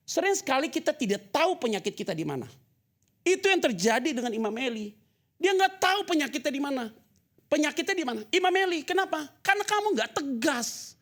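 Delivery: loud, high-pitched preaching; preaching at full force. Acted. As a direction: thunder it out to the congregation. Preaches on Imam Eli, loud and high. (khotbah berapi-api dengan suara keras dan tinggi)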